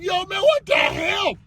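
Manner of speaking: high, drawn-out mock disbelief; slow, high-pitched incredulity; baffled onlooker. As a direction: act out an exasperated bystander. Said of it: "You still up like".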